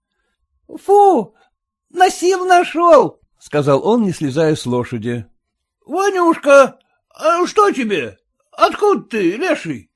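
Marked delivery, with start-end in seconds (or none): 0.7-3.2 put-on boy voice. A narrator's reading. Boy rides up breathless. 5.6-9.9 high, startled question; surprised. A narrator's reading. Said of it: "удивлённо спросил".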